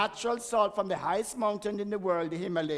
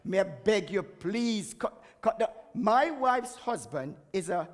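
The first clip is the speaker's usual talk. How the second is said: usual speaking voice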